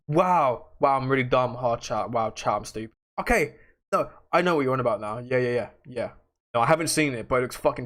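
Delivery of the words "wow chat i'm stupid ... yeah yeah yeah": ordinary delivery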